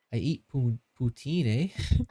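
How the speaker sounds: half-hearted mock Canadian accent with a tagged-on "eh?"; badly faked Canadian accent. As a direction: attempt a joke Canadian accent. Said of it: "I can't do a Canadian accent".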